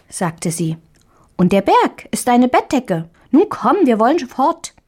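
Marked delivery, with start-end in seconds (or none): 1.4-4.7 higher squeaky little mouse, eager; a mouse hurrying the girl along. A narrator's reading. Mouse urges Marie to come away, higher squeaky voice.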